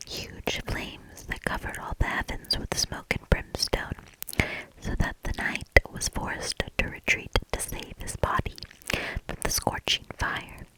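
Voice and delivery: ordinary delivery, nothing marked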